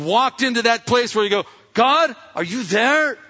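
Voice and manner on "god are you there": high, loud, drawn-out plea; calling to God. Acted. Believer in a tough time doubting, calling out. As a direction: slowly call up to God, acting out doubt.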